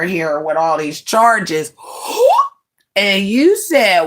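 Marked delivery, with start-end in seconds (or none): 1.8-2.5 high, sharp mock gasp; a hammed-up gasp of outrage. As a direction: gasp in fake shock.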